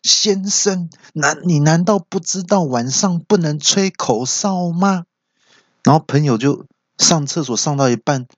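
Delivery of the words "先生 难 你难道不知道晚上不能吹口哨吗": put-on high-pitched indignant old uncle; rude scolding. Old uncle barging in on the whistler, rude. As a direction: tell off a stranger, pitched well up. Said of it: "很不客气的说".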